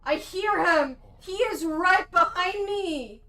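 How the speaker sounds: loud, urgent cry of fear; alarmed. Real, not acted. Player hears him right behind, alarmed. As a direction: call out as if hunted.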